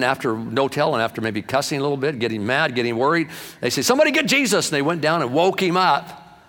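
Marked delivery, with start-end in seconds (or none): 3.8-4.7 much higher, louder frantic cry; panicked shout. Retold or imitated. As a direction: yell it like the boat is sinking. Panicked disciples in the storm.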